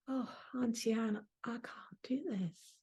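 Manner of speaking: soft, whispered admission; quiet confession. Retold or imitated. Niece admitting to her auntie she can't do this, whispered. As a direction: breathe the doubt out.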